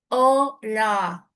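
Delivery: talking around a pencil between the teeth; pencil in mouth. Shown as a demonstration. Coach demonstrating the pencil diction drill, muffled. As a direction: say hola with a pencil in your mouth. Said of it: "el lápiz en la boca".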